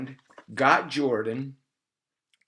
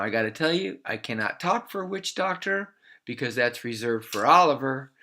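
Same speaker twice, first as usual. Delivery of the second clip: plain reading voice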